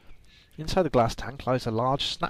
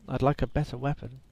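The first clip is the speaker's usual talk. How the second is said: normal talking voice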